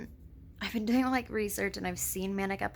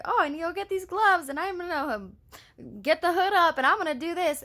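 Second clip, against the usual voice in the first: much higher and louder, bursting with plans; giddy excited self-quote; 9 semitones higher, 5 dB louder than usual. Retold or imitated. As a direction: gush like you can't wait to start. Quoting her own manic excitement.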